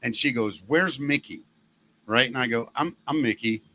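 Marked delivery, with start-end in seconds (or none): none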